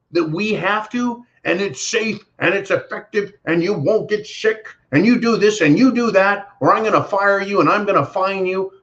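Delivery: high, mocking preachy voice; lightly voiced mock president lecturing; mocking Biden. Mocking Biden's mandate speech.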